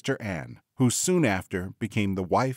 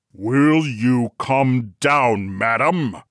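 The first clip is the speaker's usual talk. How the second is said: loud, very drawn-out furious demand; enraged Bluebeard; 3 semitones higher, 8 dB louder, much slower than usual. A narrator's reading. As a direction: bellow it slowly, word by word. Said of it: "said Bluebeard in his greatest rage".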